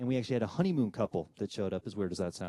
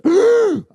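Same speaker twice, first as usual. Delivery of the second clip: loud, high gasp for air; choking gasp; 20 dB louder than usual. Retold or imitated. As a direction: wake up suddenly with a strangled gasp. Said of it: "I'm gasping for air".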